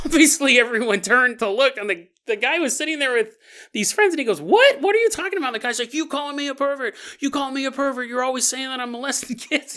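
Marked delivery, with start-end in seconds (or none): 4.4-5.5 high-pitched, incredulous comeback; indignant disbelief. Retold or imitated. Old man accused in front of friends, indignant disbelief. Retelling old man's startled reply. 5.9-9.8 high-pitched mock rant, no real volume; retold angry accusation. Young man accuses the old man, mock angry rant.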